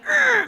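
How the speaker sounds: high loud mock growl of bad mood; grumpy growl. In a pissy mood in class, mock growl. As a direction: let out a pissy snarl. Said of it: "I'm in the".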